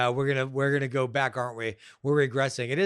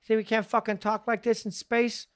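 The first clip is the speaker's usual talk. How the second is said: mock Boston accent, raised pitch; defiant Boston accent, a bit higher; 7 semitones higher than usual. Defending Bill Burr's Star Wars role, mock Boston accent. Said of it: "what's wrong with fucking Boston accent".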